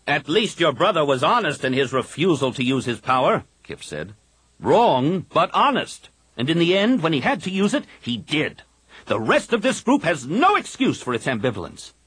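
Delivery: Kip's fervent voice. A narrator's reading. Kip urging the Jedi council to fight, insistent. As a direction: preach the fight, every word pressed.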